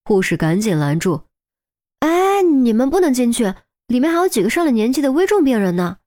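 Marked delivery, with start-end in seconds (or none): 2.0-6.0 nurse stopping them. Acted. Nurse blocking parents.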